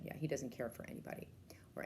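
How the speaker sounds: hushed aside whisper; confiding whisper. Real, not acted. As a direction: lean in and whisper it. Aside about Edward's vanity.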